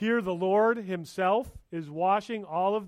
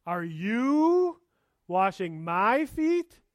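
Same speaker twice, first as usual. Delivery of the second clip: stressed YOU, slowed feet; 3 semitones higher, 5 dB louder, much slower than usual. Shown as a demonstration. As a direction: lean hard on YOU in Are you washing my feet. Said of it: "The emphasis in that sentence is really important".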